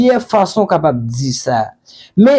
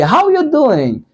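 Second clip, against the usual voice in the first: lively example; 8 semitones higher than usual. Shown as a demonstration. Example sentence in lesson.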